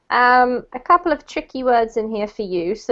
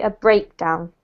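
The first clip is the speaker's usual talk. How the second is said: more weight on BREAK, less on down; stress on first syllable; 4 semitones lower than usual. Shown as a demonstration. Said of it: "less emphasis on this one".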